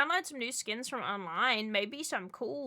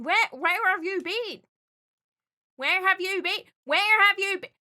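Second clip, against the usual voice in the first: mock demand; 7 semitones higher, 8 dB louder than usual. Mock scolding returning viewer.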